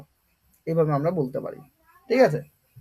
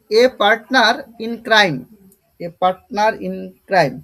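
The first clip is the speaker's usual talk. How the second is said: a partner in crime, very slow and loud with weight on a; slow model reading; 5 dB louder, much slower than usual. Shown as a demonstration. Teacher modelling the English phrase, slow and loud. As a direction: read it very slowly and loudly for the class. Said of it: "teacher models the phrase".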